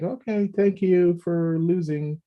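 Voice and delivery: imitated polite thanks. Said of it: "she was like".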